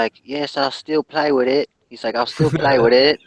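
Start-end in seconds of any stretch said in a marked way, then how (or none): 0.2-1.7 higher, louder mock Australian kid; imitated Australian accent. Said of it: "he's speaking in that accent". 2.2-3.2 higher, louder mock Australian kid; imitated Australian accent. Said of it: "he's speaking in that accent".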